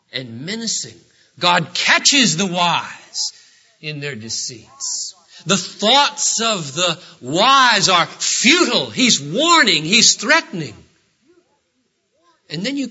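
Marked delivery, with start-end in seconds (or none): 1.4-10.7 preaching. Acted. Stern warning passage.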